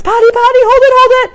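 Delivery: put-on high, loud, urgent toddler chant; shrill mock toddler announcement; toddler shouting sequence. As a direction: shout it like a small boy needing the bathroom. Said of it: "this is our another sequence".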